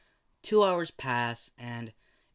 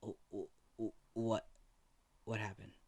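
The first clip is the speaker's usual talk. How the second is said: put-on shocked old man; 3 semitones lower, 11 dB quieter than usual. Old man hears daughter died.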